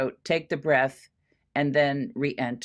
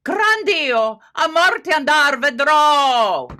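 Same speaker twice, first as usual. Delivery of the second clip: each phrase louder and higher; building intensity; 9 semitones higher, 8 dB louder, slower than usual. Shown as a demonstration. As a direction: build with each statement, up to the last. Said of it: "each one of those softer building".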